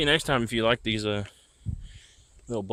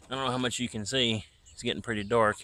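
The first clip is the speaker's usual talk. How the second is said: ordinary talk, nothing marked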